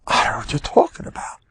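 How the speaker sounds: quick, hushed, high denial; mock innocence. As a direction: whisper it like a baffled innocent. Older sailor pleads ignorance.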